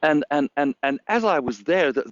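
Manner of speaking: ordinary talk, nothing marked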